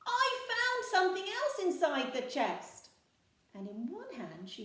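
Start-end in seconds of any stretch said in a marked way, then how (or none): 0.1-2.9 much higher, loud excited news; rushing to share a find; excited pirate girl. A narrator's reading. Peg Polkadot tells crew her find, excited. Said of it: "she cried".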